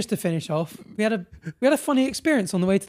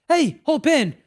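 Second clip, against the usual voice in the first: friendly boatman; 9 semitones higher, 6 dB louder, slower than usual. Retold or imitated. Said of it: "He says to the religious man".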